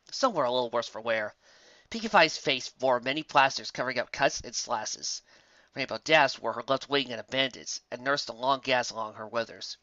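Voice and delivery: plain reading voice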